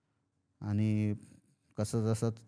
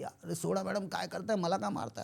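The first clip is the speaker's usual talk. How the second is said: higher pitched, desperate begging to let go; panicked pleading; 6 semitones higher, 3 dB quieter than usual. Retold or imitated. Man being strangled begs the lady to let go, panicked pleading.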